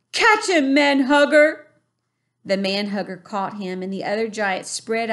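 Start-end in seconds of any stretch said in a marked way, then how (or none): 0.1-1.7 high, loud giant yell; giant shout. A narrator's reading. Giant tossing the BFG to another, a loud shout. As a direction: fling it out like a playground taunt.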